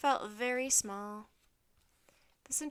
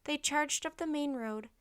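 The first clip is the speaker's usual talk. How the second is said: usual speaking voice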